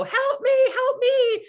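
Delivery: put-on very high, loud child scream; yelling for help. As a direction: shriek for help out the car window. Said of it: "I was yelling out the window".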